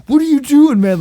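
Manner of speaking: mock yell. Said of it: "he's like".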